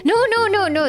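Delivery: usual speaking voice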